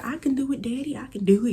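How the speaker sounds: put-on high eager kid voice; child insisting. As a child telling dad she can do it, high and eager. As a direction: play the small kid begging to go.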